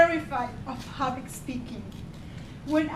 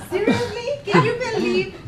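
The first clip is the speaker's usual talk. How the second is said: mock disbelief; 6 semitones higher, 6 dB louder than usual. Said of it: "mock disbelief".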